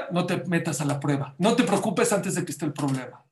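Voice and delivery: ordinary talk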